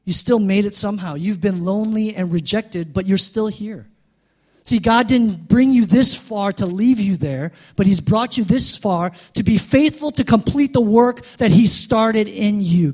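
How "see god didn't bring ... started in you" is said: preaching. Real, not acted. Preaching builds up again.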